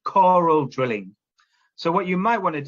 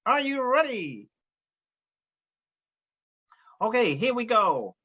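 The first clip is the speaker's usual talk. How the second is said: teacher voicing a cowboy puppet to greet the class; Woody puppet voice; 8 semitones higher than usual. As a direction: give the puppet a bright greeting voice. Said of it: "Woody's gonna take over the teacher role".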